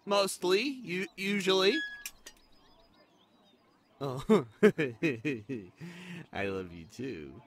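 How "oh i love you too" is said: slow, laughing affectionate reply; laughing fondly. Acted.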